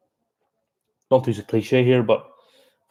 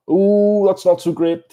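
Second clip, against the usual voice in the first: higher, drawn-out ooh of dismay; dismayed reaction; 8 semitones higher, 3 dB louder than usual. Real, not acted. Commentator reacting to a poor play, dismayed. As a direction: react live to a mistake going wrong.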